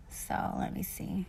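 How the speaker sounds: soft unvoiced mutter while searching; whispered to herself. Real, not acted. Reading off her screen, whispering to herself. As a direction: whisper it to yourself as you look.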